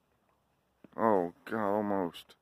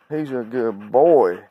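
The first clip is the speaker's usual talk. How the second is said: slow drawn-out praise. Real, not acted. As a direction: savour each word, slowly, for the dog. Praising the dog.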